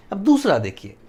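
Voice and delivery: normal talking voice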